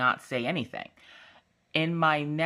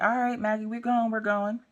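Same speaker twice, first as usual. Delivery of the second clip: high, sing-out voice giving in; playful announcing; 9 semitones higher than usual. Retold or imitated. Mom pretending to take Maggie out, playfully high. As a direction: call it out high, as if obliging.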